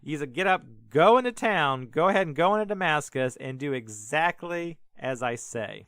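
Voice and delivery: loud, firm order from above; Jesus barking orders from the sky; commanding. Retold or imitated. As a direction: command him to rise. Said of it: "he says".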